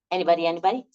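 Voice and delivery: ordinary delivery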